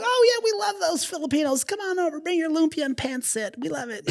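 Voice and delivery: high-pitched, fading mock enthusiasm; gushing admirers. Outsiders inviting Filipinos over for food, gushing. Voicing patronizing fans of Filipinos.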